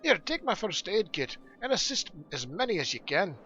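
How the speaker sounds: lively dwarf voice appealing for help; brisk dwarf leader recruiting a helper; earnest dwarf quest giver. Acted. Dwarf asking Hurgrim to help bandage the wounded, earnest.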